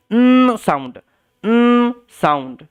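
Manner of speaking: "উম" as a long held nnn, then "sound"; N sound. Shown as a demonstration. Teacher showing the sound for pronouncing N, held nnn. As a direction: hold the bare consonant n in "উম", no vowel. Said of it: "pronounce করার জন্য".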